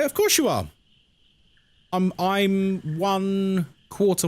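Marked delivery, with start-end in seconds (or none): 0.0-0.7 mock reassurance. Reassuring an imagined viewer.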